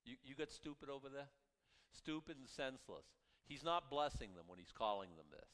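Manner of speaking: ordinary delivery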